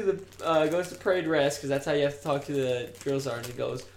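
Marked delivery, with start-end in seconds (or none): none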